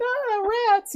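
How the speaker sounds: squeaky high little yelp; mock frightened lady. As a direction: squeak it like she's scared of mice. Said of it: "and she's like".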